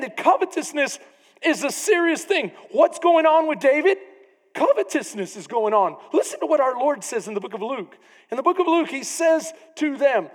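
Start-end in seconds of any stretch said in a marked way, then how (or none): none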